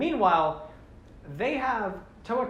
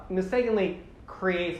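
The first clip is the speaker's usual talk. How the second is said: ordinary talk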